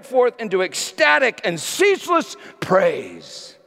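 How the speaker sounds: preached at full force, high and loud; fiery preaching. Acted. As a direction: thunder it out to the congregation.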